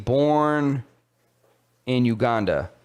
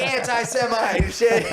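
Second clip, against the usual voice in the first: mock outraged shout; 9 semitones higher than usual. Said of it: "They're like".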